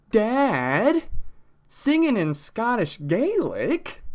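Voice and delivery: put-on a child who can't believe it, drawn out; incredulous kid. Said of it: "And you're thinking".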